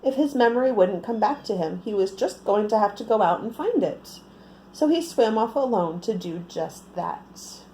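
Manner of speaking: plain reading voice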